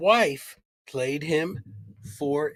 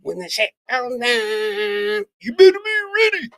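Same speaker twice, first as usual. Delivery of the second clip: much higher, louder rap-style hook, speeding up at the end; 10 semitones higher, 5 dB louder than usual. Retold or imitated. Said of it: "my backup Cypress Hill guy".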